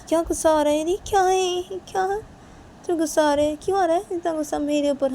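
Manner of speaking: high-pitched needling questions; provoking challenge. Acted. Asking the angry one why so angry, provoking. Acted taunt after anger warning.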